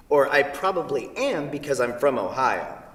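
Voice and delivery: usual speaking voice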